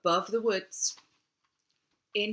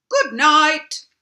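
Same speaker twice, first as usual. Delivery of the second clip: a cheery king calling good night, louder and higher; king's goodnight; 8 semitones higher, 12 dB louder than usual. Acted. King says goodnight to son.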